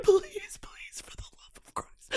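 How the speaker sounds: voiceless pleading whisper; begging whisper. Pretend. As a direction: beg in a desperate whisper. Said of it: "My skin's like".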